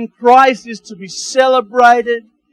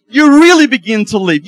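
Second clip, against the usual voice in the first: the word really punched loud and held; REALLY stressed; 7 dB louder than usual. Acted. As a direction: lean hard on "really" in the repeat. Repeating it with emphasis.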